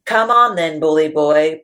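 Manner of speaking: loud defiant roar; loud challenging goat; goat roaring back. Acted. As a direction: roar the dare back at him. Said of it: "Big Bully roars".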